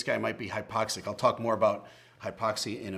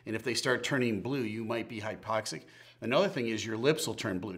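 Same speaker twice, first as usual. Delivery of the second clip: normal talking voice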